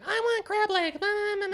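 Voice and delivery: put-on very high whiny child voice; whiny kid. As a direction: squeak it like a bratty six-year-old. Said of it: "He's like".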